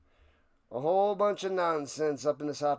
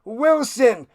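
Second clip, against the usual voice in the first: much louder, higher excited announcer call; play-by-play call; 6 semitones higher, 10 dB louder than usual. Acted. Streamer calls the fumble return live, shouting excitedly. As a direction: yell out the fumble like a broadcaster.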